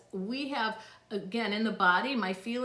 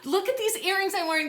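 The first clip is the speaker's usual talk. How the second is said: excited gasp; 10 semitones higher, 5 dB louder, faster than usual. Real, not acted. Thrilled showing son's gift.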